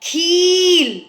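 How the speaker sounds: "खील" with the ee held very long; sustained ee in "खील". Shown as a demonstration. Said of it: "बड़ी ई में लगा देते".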